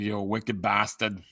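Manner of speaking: put-on slow New England Boston accent. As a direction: say it like a Bostonian, dropping the r. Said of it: "like a Boston accent".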